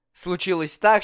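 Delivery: ordinary delivery, nothing marked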